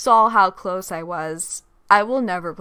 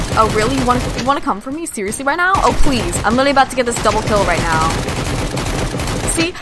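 louder, rapid gamer trash talk; fast excited taunting; 4 semitones higher, 7 dB louder, much faster than usual. Real, not acted. Gamer taunting a rival in battle, fast and loud. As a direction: fire off a cocky taunt at the opponent.

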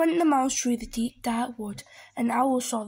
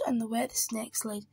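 plain reading voice

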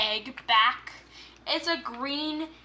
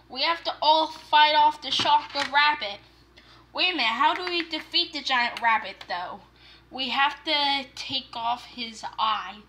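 ordinary delivery

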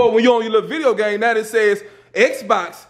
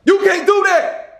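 loud, slow, high mock outrage; dad hamming up a scolding shout; 9 semitones higher, 3 dB louder, slower than usual. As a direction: bellow it like a drill sergeant. Dad scolding son refusing work.

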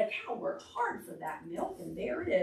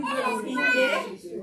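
calling out; 12 semitones higher than usual. Acted. Sheep calling for lost pig.

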